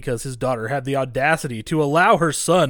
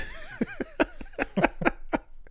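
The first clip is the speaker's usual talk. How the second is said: high squealing laugh; 7 dB quieter than usual. Real, not acted. Big laugh after quote.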